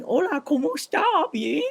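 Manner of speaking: high-pitched, mocked attempt at Spanish; bad Spanish attempt. Someone trying to talk Spanish, mock Spanish accent. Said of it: "trying to talk Spanish".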